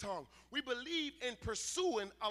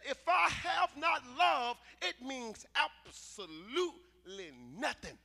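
slow, booming preacher's shout; preaching like Paul; 3 semitones higher, 7 dB louder, slower than usual. Shown as a demonstration. Preacher to congregation, quoting Paul in a slow booming shout. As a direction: thunder it out like the apostle Paul. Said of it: "I can preach like Paul".

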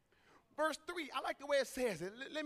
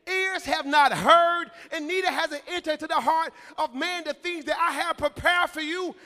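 loud, higher, building sermon cry; full-force preaching; 4 semitones higher, 10 dB louder than usual. Real, not acted. Preacher proclaiming God's promise to the hungry, full-force preaching. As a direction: drive each phrase home at full volume.

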